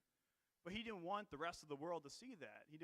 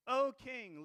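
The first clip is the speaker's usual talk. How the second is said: much louder and higher formal hail; loud royal greeting; 8 semitones higher, 11 dB louder than usual. Acted. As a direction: call it out to the throne. Chaldeans hailing the king.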